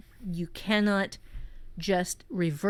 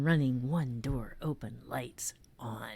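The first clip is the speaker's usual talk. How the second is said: drops from spoken to a hushed whisper; spooky trail-off, softer down to a whisper; fading to a whisper; 8 semitones lower, 7 dB quieter than usual. Acted. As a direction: let the prompt sink into an eerie whisper. Reading eerie prompt, fading.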